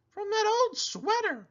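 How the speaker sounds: a llama giving friendly advice; Cherry Sue voice. A narrator's reading. Llama advising her friend the pig, high and sweet. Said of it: "said Cherry Sue".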